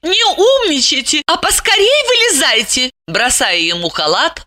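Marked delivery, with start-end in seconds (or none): 0.0-2.9 high, bossy woman's voice; exclaiming impatiently. A narrator's reading. Lipochka throws him a robe, exclaiming impatiently. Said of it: "воскликнула Липочка".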